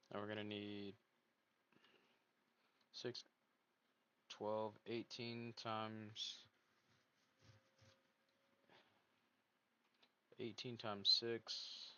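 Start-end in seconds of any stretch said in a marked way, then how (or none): 2.9-11.9 slowly counting it out; drawn out, working out math. Real, not acted. Slow mental math while counting.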